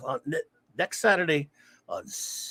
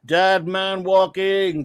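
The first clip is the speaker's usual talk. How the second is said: very slow, drawn-out name call; mock announcer call; 4 dB louder, much slower than usual. Streamer welcomes a viewer with a slow announcer call. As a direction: draw each word out like a big introduction.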